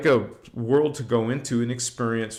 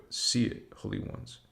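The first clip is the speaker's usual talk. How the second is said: whispered blessing; 2 semitones lower, 9 dB quieter than usual. Acted. Whispered blessing after affirmations.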